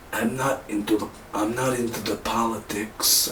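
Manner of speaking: a bad Wakandan accent drifting toward Brooklyn; quiet accent imitation that lands on Brooklyn. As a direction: try T'Challa's accent but slide into Brooklyn. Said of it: "now I'm just sounding like a Brooklyn guy".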